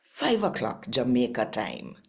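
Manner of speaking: usual speaking voice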